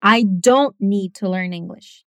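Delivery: slow, "don't" punched hard; stress on don't. Shown as a demonstration. Teacher practising an emphatic negative, stressing don't.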